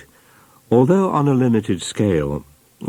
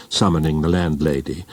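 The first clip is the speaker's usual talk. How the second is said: usual speaking voice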